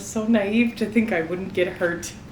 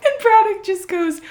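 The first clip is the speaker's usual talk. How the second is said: usual speaking voice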